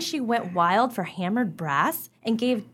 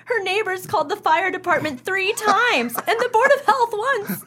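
laughing through the words, higher; 9 semitones higher, 4 dB louder than usual. Acted. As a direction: tell it while giggling. Wife laughing at neighbor's disasters.

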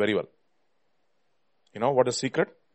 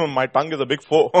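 ordinary delivery

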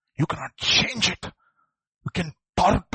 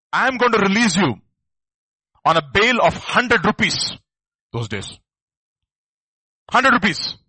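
mock judge; 3 semitones higher, 6 dB louder than usual. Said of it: "They say".

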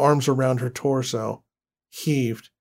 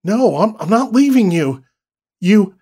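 loud, higher desperate no; desperate refusal; 6 semitones higher, 6 dB louder than usual. Acted. Husband refuses to leave his drowning wife, desperate and loud. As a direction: refuse with everything you have.

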